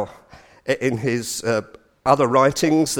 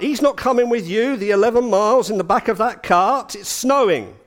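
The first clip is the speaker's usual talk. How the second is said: a hint of a protective mother, pitched higher; mildly voiced mother objecting, higher; mum piping up; 7 semitones higher than usual. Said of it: "And Mum pipes up".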